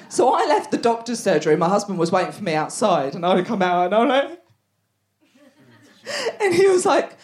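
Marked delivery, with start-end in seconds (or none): none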